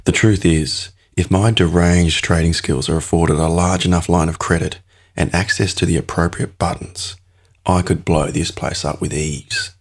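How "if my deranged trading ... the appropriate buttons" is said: ordinary delivery, nothing marked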